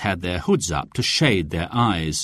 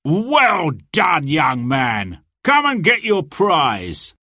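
hearty congratulation; 6 semitones higher, slower than usual. A narrator's reading. Sheriff congratulates the winning archer, hearty call. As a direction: hail the winner across the square. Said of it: "cried the sheriff".

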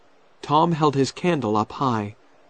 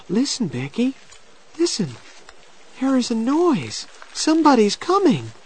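urgent excitement; 12 semitones higher than usual. A narrator's reading. Tom hearing someone coming in the dark, urgent excitement. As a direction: alert her eagerly, pitched high.

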